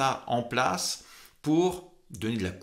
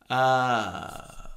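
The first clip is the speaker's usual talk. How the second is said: vocal fry. Shown as a demonstration. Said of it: "sur la fin de phrase".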